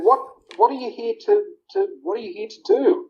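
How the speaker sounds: high, bewildered mum voice; puzzled mum. Retold or imitated. Mum asking her son why he really came, high and puzzled. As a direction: stumble as if worried and confused.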